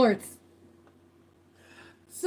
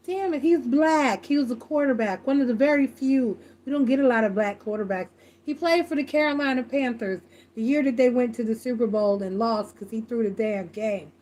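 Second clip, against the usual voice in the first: normal talking voice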